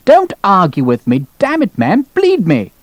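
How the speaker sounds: rising, high-pitched irritation; exasperated colonel. Acted. Colonel cuffing his objecting doctor, exasperated. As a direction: overrule him, climbing in pitch.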